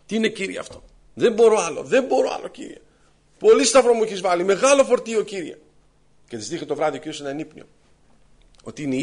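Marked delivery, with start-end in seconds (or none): 0.1-5.6 higher-pitched put-upon whine to God; grumbling complaint. Acted. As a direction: protest wearily that it is all too much. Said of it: "βαριγκομούσε για το σταυρό της".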